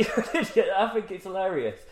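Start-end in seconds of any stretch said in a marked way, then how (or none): 0.1-0.7 higher echoing insult; echo reply. Retold or imitated. The echo answering the Grinch, higher. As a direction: throw it back like an echo. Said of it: "and he's like".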